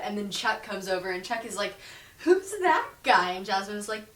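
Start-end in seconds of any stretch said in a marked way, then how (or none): 2.2-3.3 higher, slow nosy question; mock curious. Chuck asking Jasmine about Derek, higher and slow. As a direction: ask like a jealous coach.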